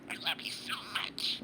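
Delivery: whispery little bird voice copying words; chirpy talking budgie; parakeet chatter. Real, not acted. Parakeet echoes owner.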